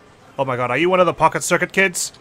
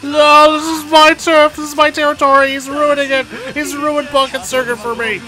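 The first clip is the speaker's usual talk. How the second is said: higher, louder frantic cries; panicked yelling; 8 semitones higher, 4 dB louder than usual. Real, not acted. Player losing the pocket circuit race, frantic yelling. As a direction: shout like everything is slipping away.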